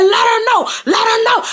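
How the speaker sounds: high, goading mock holler; retold scream. Daughter egging on the family, high mock scream. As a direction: repeat it as a high taunting yell. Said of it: "I'm screaming at the family".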